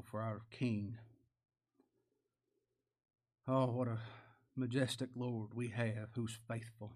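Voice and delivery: hushed, unhurried thanks to God; reverent prayer. Real, not acted. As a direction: pray aloud quietly and humbly, phrase by phrase. Leads closing prayer.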